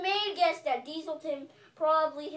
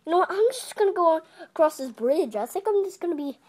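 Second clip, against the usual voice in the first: loud grumpy character; grumbling train voice; 10 dB louder, faster than usual. Pretend. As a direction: complain loudly about the brother. Toy train complaining about brother.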